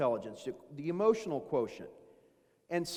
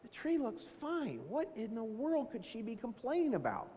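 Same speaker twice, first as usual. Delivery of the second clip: a quiet mock puzzled mutter; puzzled inner thought; 5 semitones higher, 7 dB quieter than usual. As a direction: wonder aloud, baffled, under your breath. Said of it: "I'm like".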